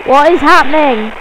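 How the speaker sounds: loud outburst of confusion; bewildered shout. Real, not acted. Stuck on a broken Skype call, bewildered shout.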